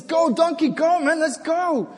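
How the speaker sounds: high, loud, pushy rider's shout; an impatient rider shouting go; urging the donkey on. Acted. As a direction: kick the donkey forward and yell at it. Balaam urging on his donkey.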